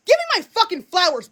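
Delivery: usual speaking voice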